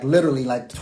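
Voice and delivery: ordinary talk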